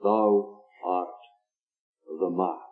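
low, soft, grave "Thou art the man"; hushed accusation. Acted. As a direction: drop the voice for the verdict. Prophet's accusation quoted in sermon.

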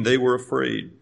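flat, quiet, offhand They were afraid; nonchalant. Shown as a demonstration. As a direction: read it dry and understated. Reads KJV flatly, calls it nonchalant.

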